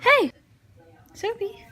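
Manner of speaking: loud high call. Real, not acted. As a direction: shout the dog's name across the yard. Calling the dog outside.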